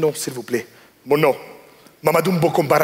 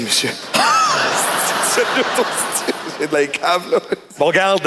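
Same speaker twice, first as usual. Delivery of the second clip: ordinary delivery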